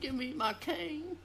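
put-on high, slow old man asking for his cane. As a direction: croak it like a frail grandpa. Old man joke continued.

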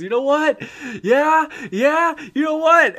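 very high, loud, worked-up taunt; upset. Retold or imitated. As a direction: imitate a party leader about to kick someone. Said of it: "getting really upset".

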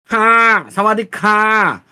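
high, slow nasal mock Chinese accent. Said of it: "Kunyari ka lang nagchichines, tas ngongo".

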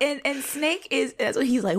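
ordinary talk, nothing marked

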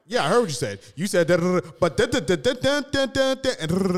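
high, loud gibberish imitation of a bad listener; mock misquoting. Mimicking someone who rearranges what was said, mocking. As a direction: rattle off their garbled version, exaggerated. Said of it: "and be like".

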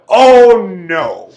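loud, drawn-out "oh no", falling away; dismayed reaction. Retold or imitated. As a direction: groan it out in exaggerated dismay. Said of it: "and I went".

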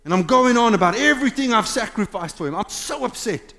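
high, loud complaining rant; retold angry rant at God. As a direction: vent it upward, aggrieved and fast. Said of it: "I'm shouting at God, going".

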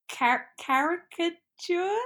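a slow, unsure go at caricature; halting attempt. Shown as a demonstration. Trying a word she can't say, unsure. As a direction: caricature said slowly and high, with a false start. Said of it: "a random one that I can't get".

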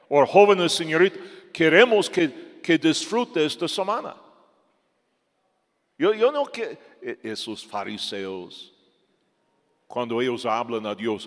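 usual speaking voice

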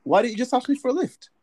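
high, surprised London roadman call-out; london roadman accent. Retold or imitated. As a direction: shout it up like a London roadman mate. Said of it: "everyone's like".

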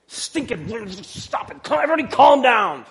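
irritated hiss building to a loud snap; grumpy, woken from a dead sleep; ticked-off Jesus. Retold or imitated. Jesus woken by scared disciples, mock annoyed. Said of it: "a little ticked off".

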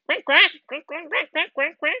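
high squawky duck quacks; quacking duck. A narrator's reading. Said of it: "starnazzò la anatra alla papera". (starnazzo acuto di papera)

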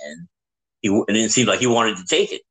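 ordinary talk

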